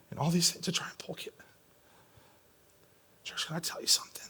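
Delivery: very soft, near-whispered confiding; hushed, moved. Real, not acted. Preacher confides his grief for abused children to church, hushed. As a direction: drop almost to a whisper, heartfelt.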